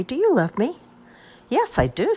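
put-on high little child asking; lightly voiced small child.

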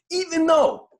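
squeaky high break. Real, not acted. Sudden emphatic restart.

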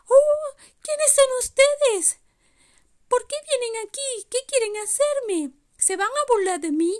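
vocecita muy aguda y temerosa; reno asustado. Acted. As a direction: grita asustado y luego pregunta como renito. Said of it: "se asustó mucho". (pequeño reno muy asustado)

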